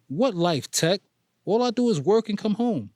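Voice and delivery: frustrated. Acted. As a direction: push back, fed up. Frustrated protest.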